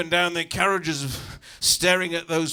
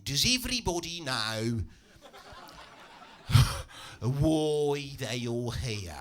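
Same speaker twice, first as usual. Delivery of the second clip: workshop greeter; 3 semitones lower, slower than usual. Acted. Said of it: "This is the greeting as I walk in".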